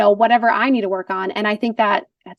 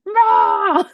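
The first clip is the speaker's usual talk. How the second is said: a higher, hammed-up monster "Rah!"; monster roar; 9 semitones higher, 3 dB louder than usual. Wife retelling how she greeted her husband, mock monster roar. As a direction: roar at him like a playful monster. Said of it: "like, monster".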